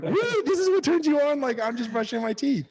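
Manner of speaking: high, laughing, incredulous; laughing disbelief. Retold or imitated. To his wife in the bathroom, high laughing disbelief. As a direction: squeal it out in happy disbelief. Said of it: "And I was like".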